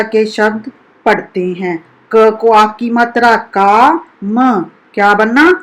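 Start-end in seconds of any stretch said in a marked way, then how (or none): none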